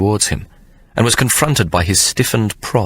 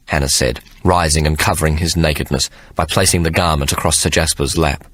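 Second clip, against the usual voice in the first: normal talking voice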